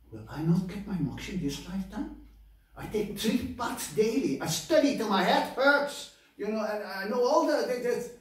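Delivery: high, loud, hammed-up outrage; indignant Brahmin protest. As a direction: protest your merits in a rising, loud whine. Proud Brahmin protests his fate.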